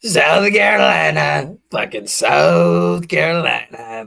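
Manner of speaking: loud, slow, drawn-out yell of the state name; mock rally shout. Mocking the speech by shouting the state name. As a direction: bellow it like a crazed rally crowd.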